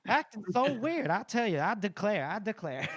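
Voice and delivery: put-on Southern accent. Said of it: "You gotta do Southern".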